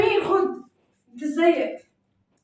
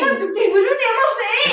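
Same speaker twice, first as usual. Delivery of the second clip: real frustration, higher and louder; exasperated outburst; 6 semitones higher, 5 dB louder, faster than usual. Real, not acted. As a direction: cry out at the family driving you crazy. Family pretends not to hear her. (بعصبية وصوت عالي)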